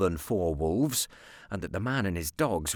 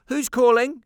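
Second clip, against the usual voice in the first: put-on higher and louder, a small boy asking; boy's voice; 13 semitones higher, 9 dB louder than usual. A narrator's reading. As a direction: voice the boy calling back, higher. Nicholas voiced in dialogue.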